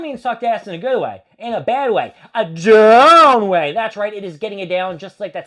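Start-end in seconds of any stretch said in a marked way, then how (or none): 2.3-3.7 "down" stretched out slow and loud; hammed-up slow loud "a down way"; drawn-out verdict. Real, not acted. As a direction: drag out "down" like a verdict. Giving the match a thumbs down.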